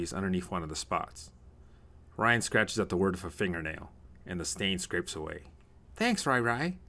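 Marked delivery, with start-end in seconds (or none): 5.9-6.8 put-on high-pitched small-child voice; grateful little boy. Tommy thanks his helpful big brother, childlike and grateful.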